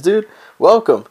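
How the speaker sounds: normal talking voice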